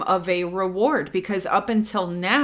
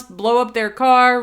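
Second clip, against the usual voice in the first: usual speaking voice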